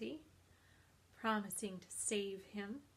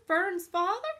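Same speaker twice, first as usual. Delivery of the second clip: high, loud wail of dismay; sobbed question; 9 semitones higher, 13 dB louder than usual. A narrator's reading. Pig hears who is in on the plot, sobbing. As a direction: wail it like a distraught piglet. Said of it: "sobbed Wilbur".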